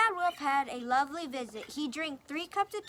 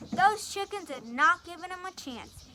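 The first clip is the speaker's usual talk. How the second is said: ordinary delivery, nothing marked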